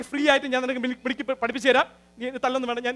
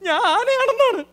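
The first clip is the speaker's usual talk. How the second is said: high, loud, laughing squeal; laughing boast; 12 semitones higher, 7 dB louder, slower than usual. Acted. Bragging to the apprentice about his buddies, a high laughing squeal. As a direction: burst out laughing at his own brag. Boasting friends call him elephant.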